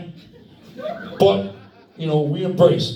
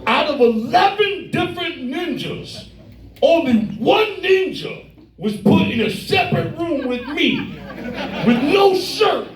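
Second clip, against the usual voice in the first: building excitement; 9 semitones higher than usual. Real, not acted. Telling the co-host the punchline, rising to an excited cry. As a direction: pitch climbing higher line by line, ending in a cry. Climax of story, rising outrage.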